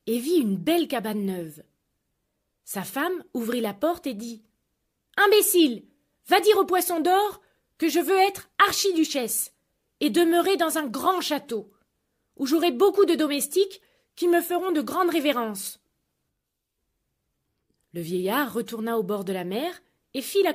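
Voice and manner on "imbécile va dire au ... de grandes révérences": loud, high contemptuous order; wife insults her husband and demands a castle; scornful demand. Acted. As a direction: scold him then command grandly.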